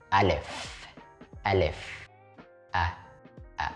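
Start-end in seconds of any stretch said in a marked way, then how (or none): none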